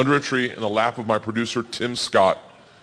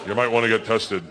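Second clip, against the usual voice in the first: low, deadpan Minnesotan producer; dry; 4 semitones lower, 3 dB quieter than usual. Acted. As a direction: break bad news drily, unruffled. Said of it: "Real dry".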